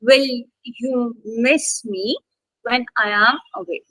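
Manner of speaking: slow, leaning on miss, me and away; stress on miss, me and away. Shown as a demonstration. Teacher modelling the question for the student, slow with stress. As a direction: read it slowly, landing on miss, me and away. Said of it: "only two stress words. Three".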